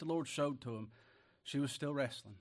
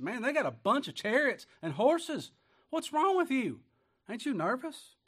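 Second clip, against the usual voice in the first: worried servant; 11 semitones higher, 7 dB louder than usual. Acted. Servant panicking at the army.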